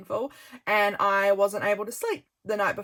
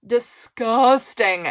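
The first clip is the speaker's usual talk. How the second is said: disgusted; 7 dB louder, much slower than usual. Real, not acted.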